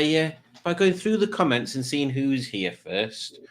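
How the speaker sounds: usual speaking voice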